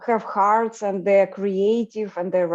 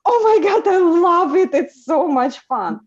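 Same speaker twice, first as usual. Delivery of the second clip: high, loud gushing; imitated excited friend; 8 semitones higher, 6 dB louder than usual. Friend loving the bogus requests, imitated with high, loud excitement. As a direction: gush like a delighted friend, mock squeal easing off. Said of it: "And she's like".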